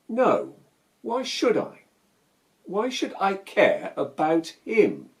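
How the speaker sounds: high, loud, defiant refusal; indignant outburst. Acted. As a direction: throw the question back indignantly, pitch jumping up. Acted outburst refusing advice to reconcile.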